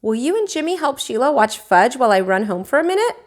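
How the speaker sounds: usual speaking voice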